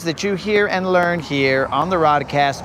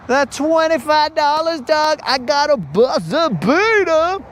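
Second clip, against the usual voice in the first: high-pitched, stretched-out gloating; excited boast; 9 semitones higher than usual. Acted. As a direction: crow it high, dragging out the ending. Boasting of a last-second catch.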